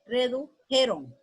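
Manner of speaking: "redujeron" despacio, con corte antes de "jeron"; enseña "redujeron" en dos trozos: "redu... jeron"; forma correcta silabeada. Shown as a demonstration. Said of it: "Jeron, miren". ("redujeron" pausado, como dictado de maestra)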